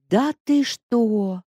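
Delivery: put-on slow, loud, high astonished old woman; second old woman, stunned, exclaiming loudly; exclaimed. A narrator's reading. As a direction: drag out the shock like a gossiping granny. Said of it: "воскликнула вторая старушенция".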